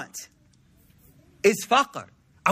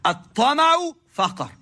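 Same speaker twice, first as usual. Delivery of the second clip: slow, raised recitation of "At-tama'u faqr"; 4 semitones higher, slower than usual. Shown as a demonstration. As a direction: intone "At-tama'u faqr" slowly, like a quoted text. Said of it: "that's what the hadith says".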